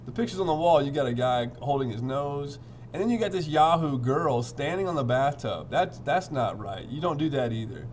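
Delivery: plain reading voice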